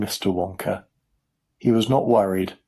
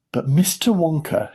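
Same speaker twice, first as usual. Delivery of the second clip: higher, mildly called out; eager shout; 7 semitones higher than usual. A narrator's reading. Boy interrupting Mister Wonka, mild eager shout. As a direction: call out eagerly without much volume. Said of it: "shouted Mike T V".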